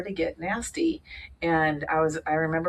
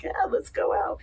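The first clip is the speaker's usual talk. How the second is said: very high, breathy mock party call; young party voice. Imitating her younger self eager to party, in a high mock voice. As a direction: chirp it like your younger self. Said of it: "I'm like".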